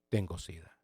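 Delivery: very soft, low inner realization; susto callado. Retold or imitated. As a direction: retell the dreaded thought, almost to yourself. Se dice la temida sentencia. (decirlo para sí, muy bajo)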